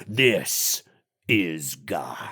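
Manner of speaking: Freddy mocking a prayer; low Freddy voice. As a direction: answer low and menacing. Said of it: "low Freddy Krueger".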